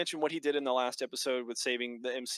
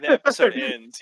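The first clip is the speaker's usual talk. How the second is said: much higher and louder, bursting out; excited; 11 semitones higher, 12 dB louder than usual. Real, not acted. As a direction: blurt it out, hyped by the cliffhanger. Excited recap of cliffhanger.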